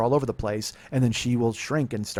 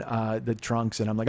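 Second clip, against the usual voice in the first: usual speaking voice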